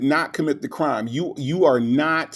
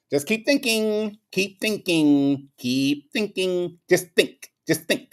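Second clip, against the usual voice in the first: mocking woman's advice; 3 semitones higher, slower than usual. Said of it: "she can't even understand".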